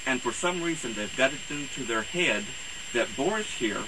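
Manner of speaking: usual speaking voice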